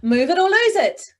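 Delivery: excited. Shown as a demonstration. Coach demonstrating an excited take for the class. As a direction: say it bursting with excitement.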